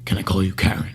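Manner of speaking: low, soft character impression; thinking while speaking. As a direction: imitate someone weighing each word. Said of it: "as he's speaking, I feel like he's thinking".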